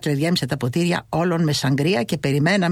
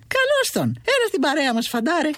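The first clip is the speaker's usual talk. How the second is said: much higher, delighted greeting; joyful welcome; 9 semitones higher than usual. Acted. Mother greets her son at the door, high and delighted.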